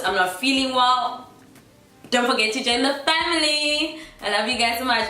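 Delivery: blocked nose, sick and nasal. Real, not acted. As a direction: sound nasal like you have a cold. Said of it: "Forgive the nasally voice".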